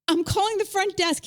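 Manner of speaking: high-pitched retold panic; mock-frantic resolve; panicked insistence. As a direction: announce it as an acted, worked-up threat. Acted panicked quarrel with husband.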